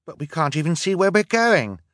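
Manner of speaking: high, loud alarmed cry. A narrator's reading. Sister cries out to her siblings in the smoke. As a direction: cry out over the fire. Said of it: "Violet cried".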